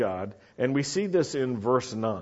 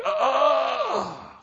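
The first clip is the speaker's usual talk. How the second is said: high, straining "ah" groan; drawn-out, high anguished groan, acted; wordless prayer groan; 12 semitones higher, 3 dB louder than usual. Shown as a demonstration. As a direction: groan out what words can't express. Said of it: "It comes out with groans and with sounds".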